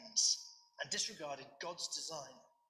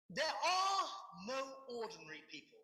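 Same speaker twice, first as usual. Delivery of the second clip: shouted quote; 6 semitones higher than usual. Real, not acted. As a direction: belt out the opening of the quote. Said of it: "Shouting again".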